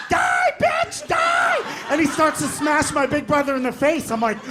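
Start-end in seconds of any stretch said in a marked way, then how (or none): 0.1-1.6 high shrieked battle cry; screaming kid. Retold or imitated. Kid screaming at big brother while hitting him. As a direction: shriek it while swinging the joystick.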